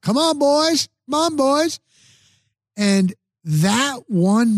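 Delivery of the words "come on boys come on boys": high cheering yell. Retold or imitated. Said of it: "I said it every chance I got".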